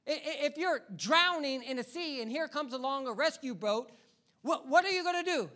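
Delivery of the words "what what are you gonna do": ordinary delivery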